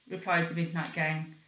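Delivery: usual speaking voice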